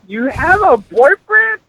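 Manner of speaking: hammed-up squeal of outrage; mock incredulous. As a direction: act out a shocked dad, voice shooting way up. Retold father reacting to boyfriend news.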